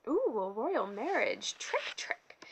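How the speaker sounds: gloating cackle, slow and loud; cackling. A narrator's reading. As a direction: crow over the winning cards. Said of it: "he cackled".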